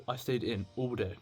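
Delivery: ordinary delivery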